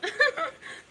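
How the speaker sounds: high teary laugh. Real, not acted. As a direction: laugh through rising tears. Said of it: "I'm getting a little emotional".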